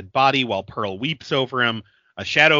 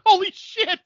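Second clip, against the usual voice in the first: shocked; 5 dB louder than usual. Retold or imitated. Reacting to the bad accent.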